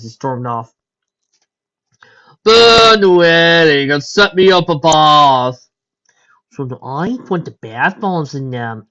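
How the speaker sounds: usual speaking voice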